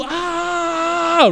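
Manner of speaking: drawn-out high demo scream; mock scream. Director asks sailor to copy it, mock scream. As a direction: hold a high pretend scream without full volume. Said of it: "you're gonna scream".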